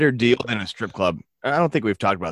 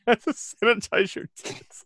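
laughing; 12 semitones higher, much slower than usual. Real, not acted. Laughing repeat of title.

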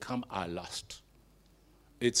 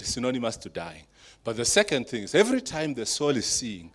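normal talking voice

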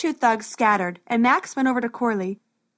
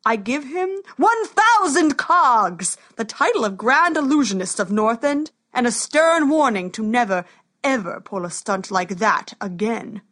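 reward then sternly warn; booming warrior king; 4 dB louder than usual. Acted.

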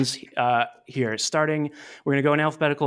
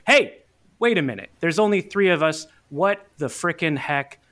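heated. Pretend. Host demanding to know where the missing co-host is, mock heated. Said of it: "I get a little heated sometimes".

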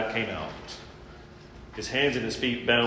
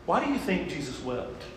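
ordinary talk, nothing marked

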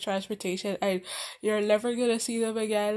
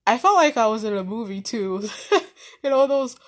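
laughing through the words, louder; 7 dB louder than usual. Real, not acted. Telling viewers it felt like a rom-com, laughing. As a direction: giggle while you say it. Laughing at her own romance.

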